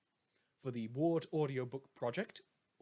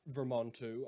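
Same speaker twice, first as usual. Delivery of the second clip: usual speaking voice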